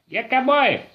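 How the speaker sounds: high whooping "Yeah, cowboy!". Pretend. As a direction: whoop it like a rodeo rider. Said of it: "Talk like a cowboy".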